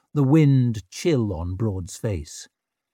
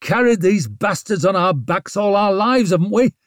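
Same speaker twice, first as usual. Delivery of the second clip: angry rant; 8 semitones higher, 5 dB louder than usual. A narrator's reading. Scowling man rants.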